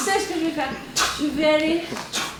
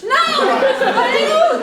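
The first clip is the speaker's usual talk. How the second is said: high frantic cry with laughter; panicked scream; 10 semitones higher, 5 dB louder than usual. Acted. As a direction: squeal in playful fright. Child panics at wolves.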